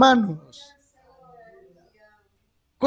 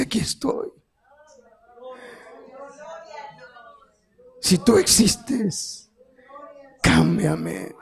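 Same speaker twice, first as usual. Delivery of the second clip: quiet prayer; 7 dB quieter, slower than usual. Retold or imitated. Retelling his first prayer, hushed and slow. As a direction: breathe it like a private plea.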